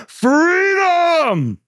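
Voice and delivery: a long, high, roaring yell of freedom; roaring battle cry. Retold or imitated. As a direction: throw all your strength into one defiant shout. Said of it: "guttural roar, he yells out".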